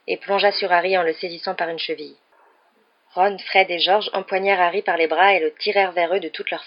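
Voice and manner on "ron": ordinary delivery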